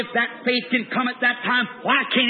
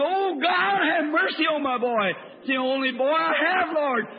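retold scream; 5 semitones higher than usual. Father begs God for his falling boy, retold scream. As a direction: re-enact a pleading scream, pitch soaring then sinking. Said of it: "I, I screamed".

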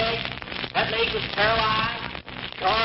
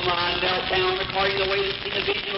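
normal talking voice

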